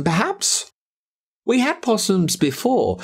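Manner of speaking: plain reading voice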